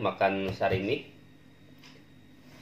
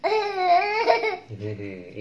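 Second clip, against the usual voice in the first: high giggle. Real, not acted. Laughing at shy host.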